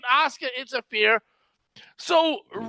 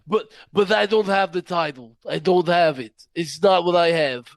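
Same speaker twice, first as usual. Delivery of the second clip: mock Russian accent; 6 semitones lower than usual. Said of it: "I know it's a Russian accent".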